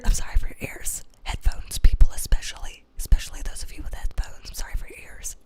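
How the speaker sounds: soft, voiceless whisper; whispered apology. Real, not acted. Apologizing to headphone listeners for their ears, whispered. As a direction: whisper soothingly to sore ears.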